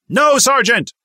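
very high, very loud slow yell; yell at full voice. A narrator's reading. Recruit answering his sergeant, full-voice yell. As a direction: yell it as loud as you can.

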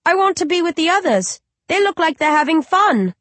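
put-on high, innocent little child asking; a small uncanny baby calling its mother. Undead baby asks its grieving mother, high and childlike.

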